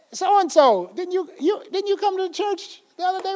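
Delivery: high, soft mock questioning of a kid; acted questioning. Acted questioning of a child at store.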